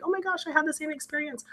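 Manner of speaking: high, soft voice of another woman; imitated amazed reaction. Woman from same program relating, mock amazed. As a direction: lift it high and light, quoting her.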